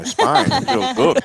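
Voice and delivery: laughing, pitch jumps up high. Real, not acted. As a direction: laugh through the words. Jokes about the flavour hitting him.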